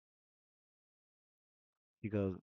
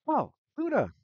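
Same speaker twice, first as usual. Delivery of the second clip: high, louder hail to a friend; excited greeting; 7 dB louder than usual. Acted. Hyperactive mage hailing Luna as she rushes past, excited. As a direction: call out the name in high-pitched excitement. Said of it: "She's like".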